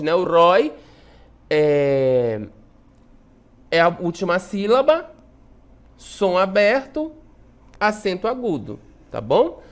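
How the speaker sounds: ordinary talk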